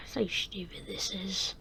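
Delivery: worn-out whispering mechanic; tired whisper. Acted. Mechanic telling the guard he loves work, tired whisper. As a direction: sigh it out in a weary whisper.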